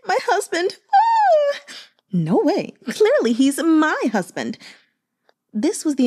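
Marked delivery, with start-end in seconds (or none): 0.1-0.8 a very high, laughing coo; a maiden giggling as she calls him her husband; giggly swooning. Acted. Maidens fawning over Xi Hejun. 0.9-1.6 a very high, loud shriek of delight; fangirl squeal. Acted. Maiden squealing over Xi Hejun. 2.1-4.6 playful squabbling. Acted. Maidens squabbling over Xi Hejun.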